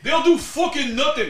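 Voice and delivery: a hint of a high, slow Irish brogue; half-hearted Irish fighter impression; lightly voiced Irish trash talk; limited Conor McGregor. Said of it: "a very limited Conor McGregor impression".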